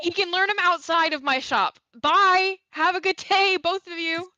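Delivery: high strained politeness; high, tight shopkeeper. Acted. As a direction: shoo them out brightly. Macy dismissing them.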